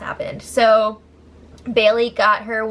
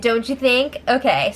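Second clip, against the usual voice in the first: ordinary talk, nothing marked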